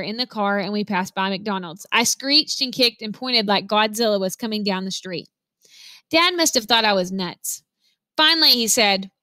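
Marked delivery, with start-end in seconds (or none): none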